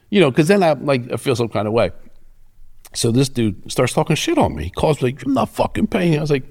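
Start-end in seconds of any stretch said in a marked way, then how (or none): 5.2-6.2 mock debtor voice. Quoting the debtor's refusal.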